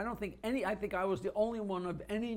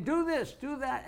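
lightly mimicked high-pitched orders; a hint of a fussy sideline coach; nagging coach father; 7 semitones higher than usual.

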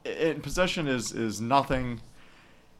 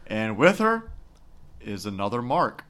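ordinary talk, nothing marked

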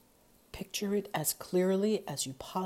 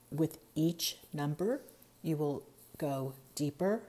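usual speaking voice

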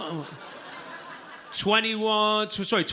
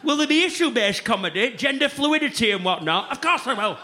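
mock pensioners; 8 semitones higher than usual. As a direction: ham up pensioners planning a comedy night. Voicing pensioners planning night out.

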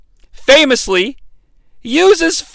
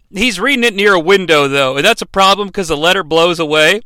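normal talking voice

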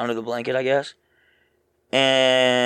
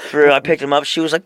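ordinary talk, nothing marked

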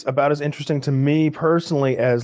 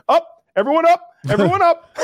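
retold high-pitched panicky shout; urgent yell; 4 dB louder than usual. Player ordering friends back up the rope, urgent retold yell. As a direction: re-enact yelling frantically to the people overhead, voice pitched way up.

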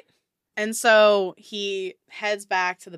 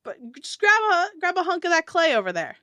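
higher, louder, fast; dwarf instructing; 11 semitones higher, 6 dB louder, faster than usual. Acted. Dwarf tells the adventurer to grab clay, high and fast. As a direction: tell them briskly to grab clay. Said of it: "he's like".

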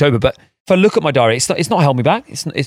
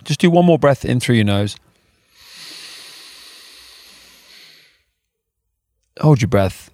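calm, paced breathing guide; guided breathing; 3 dB quieter than usual. Acted. Leads a breathing exercise.

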